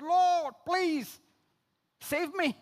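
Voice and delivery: high, drawn-out cry for help; acted drowning man's plea, pitched high; desperate plea. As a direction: plead as if going under.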